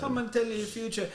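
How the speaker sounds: high mock-Jamaican fortune teller; imitated TV psychic with Jamaican lilt; put-on Jamaican accent. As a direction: sell it in a fake Jamaican show accent. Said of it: "then for her show, put her little thing on".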